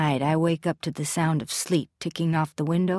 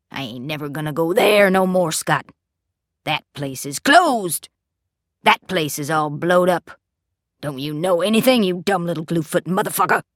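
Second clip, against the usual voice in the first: angry father rant; 3 dB louder than usual. A narrator's reading. Father rants, jittery eyes.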